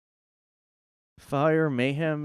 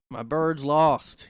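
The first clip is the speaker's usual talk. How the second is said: mock lament; 4 semitones higher, 8 dB louder, much slower than usual. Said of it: "lament over team losing".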